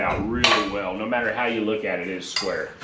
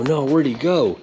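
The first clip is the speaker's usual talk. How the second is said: normal talking voice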